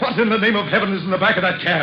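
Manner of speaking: fast, high, shaken demand; blurting it out in a fright; frightened rush. Acted. Shaken passenger demanding answers from the driver, fast and frightened.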